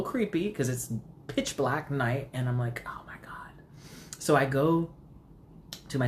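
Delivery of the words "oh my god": hushed, spooked whisper; creeped-out whisper. Retold or imitated. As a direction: whisper it to yourself in unease. Creeped out at night, retold.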